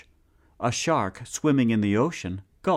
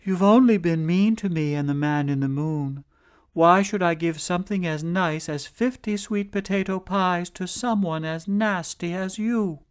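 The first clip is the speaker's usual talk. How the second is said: brave wife; 8 semitones higher than usual. Acted. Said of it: "she was both wise and brave".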